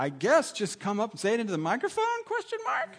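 pastor unsure, pitch leaping up at question mark; uncertain question. Retold or imitated. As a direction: end on a huge questioning rise. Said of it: "don't you love that when nobody knows".

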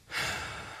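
soft breathy sigh; short wordless exhale. Real, not acted. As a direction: let out a quiet breath. Pause after recalling God's words.

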